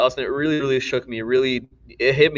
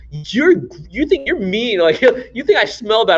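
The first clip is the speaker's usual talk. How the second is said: mock teen indignation; 8 semitones higher, slower than usual. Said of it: "you're like".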